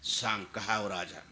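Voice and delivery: low-pitched, deliberate bargaining voice; tempting offer. Acted. Khan tempts captive with rewards.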